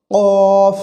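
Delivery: কফ loud, heavy and drawn out; pushed articulation. Shown as a demonstration. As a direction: push out the q of কফ and hold the rounded vowel. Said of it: "ধাক্কা দিয়ে উচ্চারণ করতে হয়".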